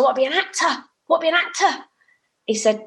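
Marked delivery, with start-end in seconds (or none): 0.0-0.6 insisting. Retold or imitated. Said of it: "I said". 0.6-1.8 the repeat goes much higher and pleads harder; stronger insisting. Retold or imitated. Retelling her protest to the agent, pitched high and pleading. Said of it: "I said".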